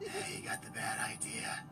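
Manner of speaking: hushed conspiratorial whisper. Acted. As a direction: whisper it to the camera. Conspiratorial vlog aside.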